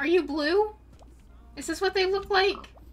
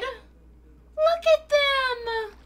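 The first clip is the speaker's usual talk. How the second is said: high, loud, drawn-out gush; delighted squeal; 9 semitones higher, 8 dB louder, much slower than usual. Real, not acted. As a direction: squeal slowly over something adorable. Delighted on spotting glow squid.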